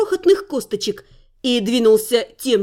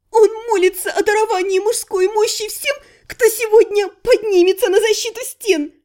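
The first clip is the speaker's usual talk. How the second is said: laughing; 7 semitones higher than usual. Acted. Still laughing while explaining.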